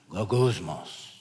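gagusmos muttered low and soft; muttered Greek word. Shown as a demonstration. Preacher showing the Greek word sounds like muttering, low. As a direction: growl out gagusmos like a grumble. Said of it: "It sounds like its meaning".

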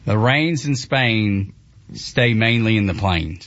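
slow, with a Southern drawl on "rains"; slow Southern attempt. Shown as a demonstration. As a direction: try the line but stay country. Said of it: "I want you to say".

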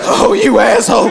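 mock outraged shout. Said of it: "You're not gonna be like".

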